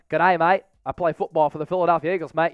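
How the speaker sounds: light mock Australian accent, a touch fast; doing a playful hint of Australian, like a cheery bloke; Aussie accent. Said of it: "everybody loves that Aussie accent".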